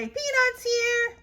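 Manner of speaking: high echo of the vendor; Eloise echoing. Acted. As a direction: copy the vendor call. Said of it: "I say".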